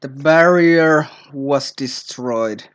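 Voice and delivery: awed reading. Real, not acted. Player reading the screen text slowly in awe. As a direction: draw it out in wonder.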